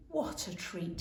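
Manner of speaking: soft, low and content; contented sigh. A narrator's reading. Mother back in warm bed, soft contented voice.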